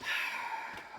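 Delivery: soft voiceless exhale; breathy wordless sigh. Real, not acted. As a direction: let out a long quiet breath. Sighs alone while playing.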